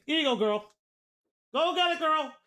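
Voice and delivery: high, sing-song voice for the dog; talking to dog. Real, not acted. Streamer giving his dog treats, high and coaxing.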